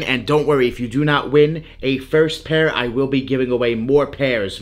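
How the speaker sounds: normal talking voice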